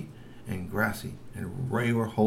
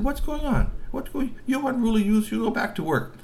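scolding troll mammy; 13 semitones higher than usual. Acted. Cousin mammies ordering the youths back to work, high scolding.

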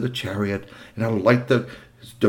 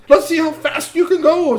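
mock teen boast; 5 dB louder than usual. Repeats the teen's urging.

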